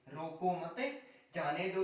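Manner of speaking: "रोको मत" in one go, break, then "जाने दो"; pause after मत. Shown as a demonstration. Teacher repeating the moved-comma example, pause after मत. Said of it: "कोमा थोड़ा सा आगे लगाता".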